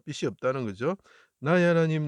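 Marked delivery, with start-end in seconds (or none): 1.4-2.1 loud anguished cry. Acted. Messiah's cry from the cross.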